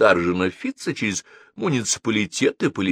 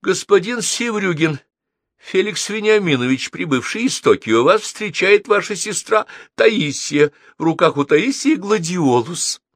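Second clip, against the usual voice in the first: polite radio announcement; 8 semitones higher than usual. A narrator's reading. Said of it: "радио любезным голосом сказала".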